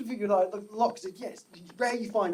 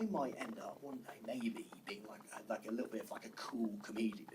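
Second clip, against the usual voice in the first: very soft, low, timid musing; hushed private thought; 8 semitones lower, 16 dB quieter than usual. Retold or imitated. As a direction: confide a modest dream almost under your breath. Voicing a shy private hope.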